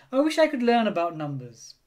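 mildly forlorn wishing; sighed wish. A narrator's reading. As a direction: say it with a longing sigh. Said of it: "she sighed".

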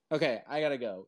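ordinary talk

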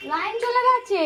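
high startled cry that the power's gone; sudden surprise. Real, not acted. Mother startled by the power cut, high surprised exclamation. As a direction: jolt up in pitch, caught off guard.

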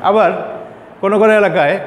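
usual speaking voice

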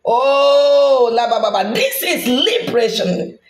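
ordinary talk, nothing marked

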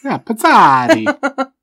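slow, high hammed-up Italian accent. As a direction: stretch Pansardi out in a big Italian accent. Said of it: "try throwing in the Italian accent".

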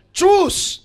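high, loud one-word order; commanding call. Acted. Elders telling the son to choose, loud command. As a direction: fire it out as a sharp, high command.

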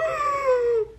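long, high gasp; excited gasp. Real, not acted. Fan reacting to [name] card, thrilled high gasp. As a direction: suck in air in delighted shock.

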